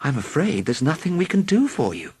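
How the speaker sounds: regretful. A narrator's reading. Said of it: "rather regretfully".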